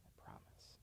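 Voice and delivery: whispered "I promise". Acted. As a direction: breathe it out unvoiced. Whispered repeat.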